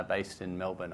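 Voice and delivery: ordinary talk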